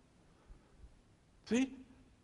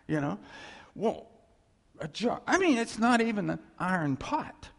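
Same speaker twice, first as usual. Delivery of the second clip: usual speaking voice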